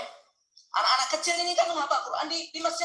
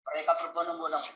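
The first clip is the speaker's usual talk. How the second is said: ordinary talk, nothing marked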